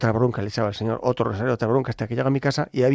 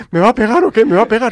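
high, loud mock fright; alarmed stranger; 9 semitones higher, 13 dB louder than usual. Cornered delivery man asking priest, hammed-up alarm. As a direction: blurt it out like someone about to be hit.

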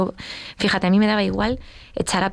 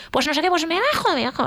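much higher, defiant retold yell; mock furious outburst; 10 semitones higher than usual. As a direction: storm out of the car in anger. Ella en la bronca del coche. (amenazando con bajarse, muy aguda)

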